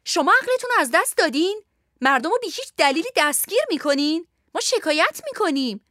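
high, outraged protest without much volume; indignant yelling. Acted. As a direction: scold them in a high, indignant cry. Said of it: "لنای سبز رو بر سرشان فریاد کشید". (فریاد اعتراض با صدای زیر)

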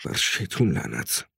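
low, soft muttered curse; under the breath. A narrator's reading. Father to himself after looking at the road, muttering low. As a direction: say it low and quietly, to yourself. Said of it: "زیر لب گفت".

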